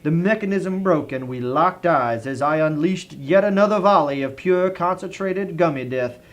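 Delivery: usual speaking voice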